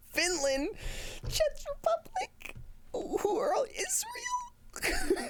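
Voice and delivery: gleeful high-pitched country roll call; excited squeals. Real, not acted. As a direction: squeal the countries out in giddy disbelief. Reading out countries in chat.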